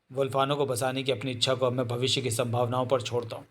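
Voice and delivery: ordinary delivery, nothing marked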